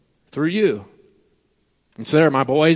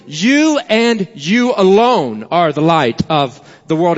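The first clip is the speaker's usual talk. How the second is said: slow, each "you" stressed hard; emphatic you. Shown as a demonstration. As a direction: hammer "you" and "you alone" home, slowly. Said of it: "it's an emphatic you".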